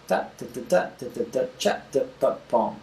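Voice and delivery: percussive syllables landing on "boom"; beatbox the drum part simply, in time; vocalized drum rhythm. Shown as a demonstration. Teacher checking where the kick goes, vocalized drum rhythm.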